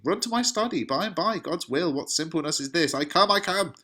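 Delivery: high, flustered call in acted panic; panicking. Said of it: "is panicking".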